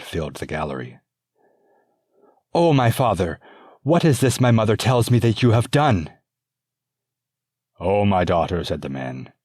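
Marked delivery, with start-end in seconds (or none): 2.5-6.1 higher, loud, indignant demand; angry princess. Acted. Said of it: "She was angry". 7.8-8.6 calm father. Acted. Father answers daughter.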